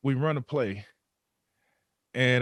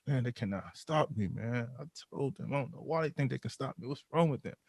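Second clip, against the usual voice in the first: cocky mutter; 2 semitones higher, 7 dB quieter than usual. Retold or imitated. Muttering to himself after scoring.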